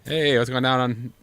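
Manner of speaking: normal talking voice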